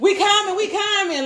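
answering the people on the live stream; high hurried call. Retold or imitated. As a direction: call out that you're coming.

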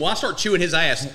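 usual speaking voice

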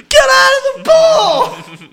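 high, drawn-out loud mock yell; slow hollered order, hammed up; imitated comedian's shout. Retelling a swim team bit, shouting. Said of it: "When he was like".

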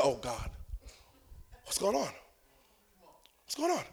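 very soft, helpless acted muttering; barely audible, as if praying under the breath; hushed dismay. Embarrassed shopper to himself after decline, hushed dismay.

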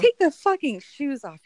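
loud, high exasperated outburst; mock scolding. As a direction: yell at a foolish character in mock outrage. Scolding the character in heels.

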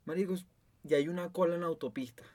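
ordinary delivery

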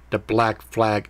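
usual speaking voice

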